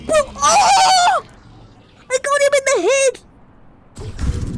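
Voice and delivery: high loud victory yell, slow; excited shout. Real, not acted. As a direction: celebrate a headshot loudly. Headshot celebration.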